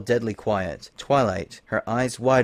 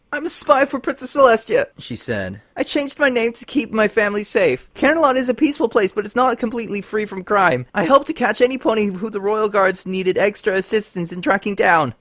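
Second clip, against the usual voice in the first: higher, strained confession; lightly voiced young mare; 10 semitones higher, 4 dB louder than usual. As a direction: confess under compulsion. Lyra's voice, compelled confession.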